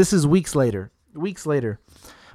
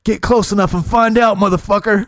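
mock tough threat; 5 semitones higher, 4 dB louder than usual. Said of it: "my dad said".

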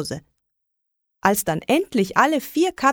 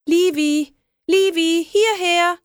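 high, loud calling for the cat; searching outside, calling the lost kitten; 10 semitones higher, 3 dB louder, slower than usual. A narrator's reading. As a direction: call the cat across the street. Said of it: "riefen wir".

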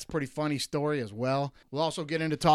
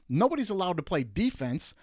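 normal talking voice

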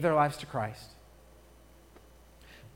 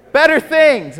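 louder echo; 9 semitones higher, 12 dB louder, slower than usual. Real, not acted. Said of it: "Everybody say".

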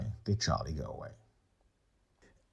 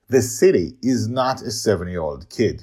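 louder and higher, firm retort; raised voice; 5 semitones higher, 12 dB louder than usual. A narrator's reading. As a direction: push the voice up to cut him off. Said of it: "Hugh raises his voice".

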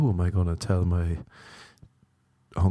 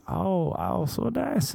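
mock high moan; 10 semitones higher, slower than usual. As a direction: squeal it like a mock lover.

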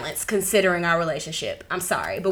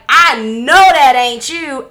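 dad's stern voice; 6 semitones higher, 10 dB louder, much slower than usual. Retold or imitated. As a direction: drag it out like an angry dad. Dad confronting, retold quote.

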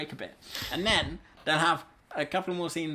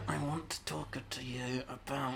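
quoting film character; 6 semitones lower, 11 dB quieter, slower than usual. Retold or imitated. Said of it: "He'll be on the phone going".

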